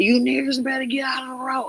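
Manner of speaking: lightly voiced hostile football fan. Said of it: "they were like".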